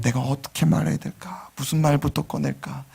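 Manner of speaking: hushed musing; whispered thought. Retold or imitated. Imagined asker whispering their worry. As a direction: whisper it to yourself.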